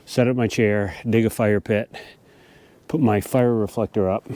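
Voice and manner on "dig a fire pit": ordinary talk, nothing marked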